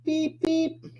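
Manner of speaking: very high, soft squeaky beep beep; a tiny cartoon horn as the bus drives off. Sending the stress bus away, squeaky high horn. Bus leaving, honking goodbye.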